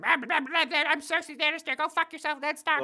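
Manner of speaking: loud, very high mock barking; Cersei. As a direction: yap furiously in a shrieked caricature. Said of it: "Cersei starts just barking".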